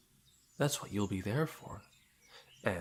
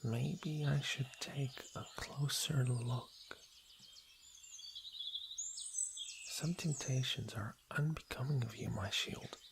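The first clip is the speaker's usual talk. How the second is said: teasing whisper; 4 semitones higher than usual. Acted. Princess leaning close to her blushing guard, whispering. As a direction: lean in and tease in a hush.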